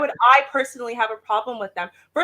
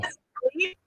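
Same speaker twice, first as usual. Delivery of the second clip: normal talking voice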